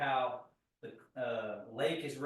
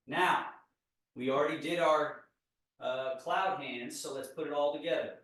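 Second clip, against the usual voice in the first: ordinary talk, nothing marked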